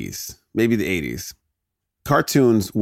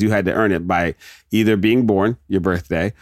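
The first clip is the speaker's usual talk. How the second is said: ordinary talk, nothing marked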